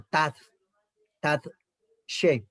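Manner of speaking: "Tat, tat, she" with clear pauses between syllables; split syllables. Shown as a demonstration. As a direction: chop the word at the syllable break. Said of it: "una sílaba que se está terminando".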